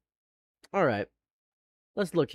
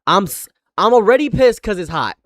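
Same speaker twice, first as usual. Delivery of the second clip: heated venting, getting louder; real anger; 6 dB louder than usual. Real, not acted. Streamer vents about being pissed in the heat, angrily. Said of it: "I'm so angry".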